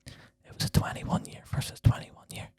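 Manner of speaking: soft secretive whisper. Pretend. Owning up to listeners behind producer's back, whispering. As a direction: confide it to listeners in a hush. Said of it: "Just keep whispering".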